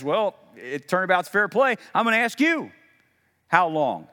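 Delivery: mock-stern God voice; God's turnabout. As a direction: toss it back with a wink. God answers fair play.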